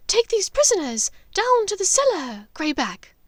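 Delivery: high, loud order across the room; calling out. Acted. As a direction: command the werewolf. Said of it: "Narcissa called".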